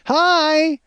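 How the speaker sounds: high, chirpy mock greeting; cheery Karen. Karen greets the strangers in the lobby, chirpy and high. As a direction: sing out a bright hello. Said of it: "Karen goes".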